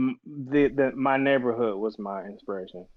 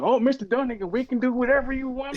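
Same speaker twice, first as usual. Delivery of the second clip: high, eager-to-please mock salesman voice; fawning salesman. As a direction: suck up to the customer you misjudged. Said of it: "came back like somebody done licked his face".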